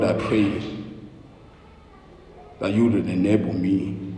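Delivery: soft, humble spoken prayer; hushed, reverent pulpit prayer. Real, not acted. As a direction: pray quietly and earnestly before God. Pastor prays before preaching.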